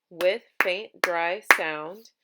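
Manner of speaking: "with faint, dry sound" in four loud, separate beats; one beat per syllable. Shown as a demonstration. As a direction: punch each word of "with faint, dry sound". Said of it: "So that's four syllables".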